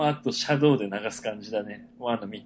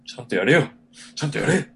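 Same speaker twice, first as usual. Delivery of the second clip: louder, mock-annoyed scolding; irritated telling-off; 4 semitones higher, 4 dB louder than usual. Host scolding his past recorded self, irritated. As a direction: scold your slumping self, half in jest. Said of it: "落ちてりゃ落ちてたでムカつくしさ".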